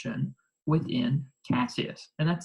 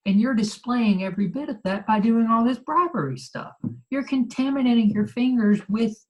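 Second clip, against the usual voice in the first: high-pitched, acted-out reproach; indignant rebuke; 8 semitones higher, 4 dB louder than usual. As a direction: scold a friend for betraying the cause. Paraphrases Brutus rebuking Cassius.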